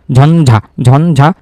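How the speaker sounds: ঝঞ্ঝা drawn out very slowly, sound by sound; ঝঞ্ঝা spoken very slowly for dictation; slow spelling out. Shown as a demonstration. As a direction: stretch each part of ঝঞ্ঝা. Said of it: "ঝঞ্ঝা, ঝ, ইয়".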